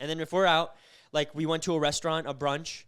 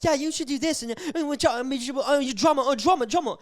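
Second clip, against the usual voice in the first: lightly mocking a silly young girl, higher; immature girl; 8 semitones higher, 5 dB louder than usual. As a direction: toss it off like a ditzy teen. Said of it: "not like an immature little girl that's like".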